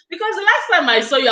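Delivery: laughing through the words, quick. Real, not acted. Telling a friend about her growth, laughing quickly. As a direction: giggle while rushing it out.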